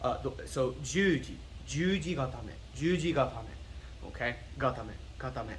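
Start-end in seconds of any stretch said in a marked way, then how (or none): 0.8-3.4 "Juji gatame" even, ending on a short "meh"; correct Japanese pronunciation. Shown as a demonstration. Teacher modelling that's how you say it, careful Japanese pronunciation. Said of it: "That's how you say it". 4.6-5.5 low, soft "gatame" ending in a short "meh"; correct Japanese pronunciation. Shown as a demonstration. Teacher repeating the term for students, model pronunciation. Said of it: "That's how you say it".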